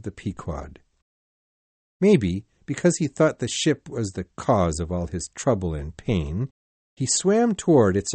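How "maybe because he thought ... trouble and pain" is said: plain reading voice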